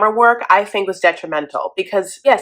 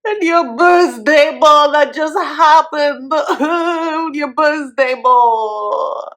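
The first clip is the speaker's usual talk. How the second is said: mocking drawl; 7 semitones higher, 3 dB louder, much slower than usual. Real, not acted. Mocking Bo's birthday ball.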